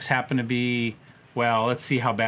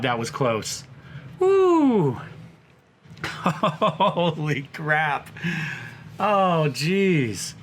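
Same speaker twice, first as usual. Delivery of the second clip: laughing with relief after a close call; relieved laughter; much slower than usual. Real, not acted. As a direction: whoop then breathless laugh. Narrow escape, whoop and laugh.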